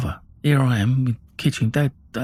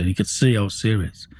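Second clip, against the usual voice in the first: plain reading voice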